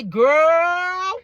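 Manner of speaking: put-on long, loud, high "girl"; emphatic cautioning call; drawn-out warning. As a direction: stretch it out like an urgent warning. Voicing women of the past warning.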